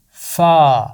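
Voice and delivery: فا as the letter name, clear and firm. Shown as a demonstration. Teacher repeating the letter name for children. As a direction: say the name فا for the class. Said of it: "اس کا نام ہے".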